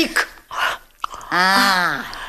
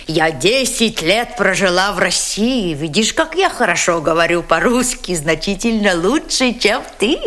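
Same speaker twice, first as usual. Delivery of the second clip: ordinary talk, nothing marked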